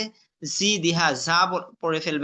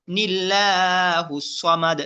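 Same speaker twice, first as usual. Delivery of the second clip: slow, measured tajweed chant; Quran recitation; much slower than usual. Shown as a demonstration. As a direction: recite with drawn-out vowels. Recitation demo.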